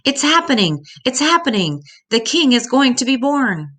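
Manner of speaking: high, loud excited cry; excited yell. A narrator's reading. Star announcing the king's birth to the others, high excited yell. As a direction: shout the news out to the whole sky. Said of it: "one of the stars yelled".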